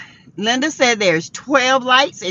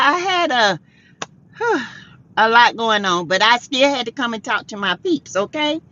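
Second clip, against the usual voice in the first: ordinary delivery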